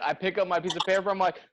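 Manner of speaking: ordinary delivery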